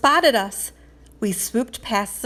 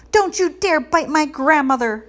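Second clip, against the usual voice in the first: high, loud desperate cry; cried out; 7 semitones higher, 5 dB louder than usual. A narrator's reading. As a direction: shout to protect her grandmother. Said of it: "she cried out".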